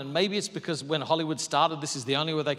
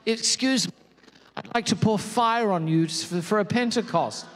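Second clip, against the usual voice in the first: mock polite; 5 semitones higher, 5 dB louder than usual. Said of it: "it was".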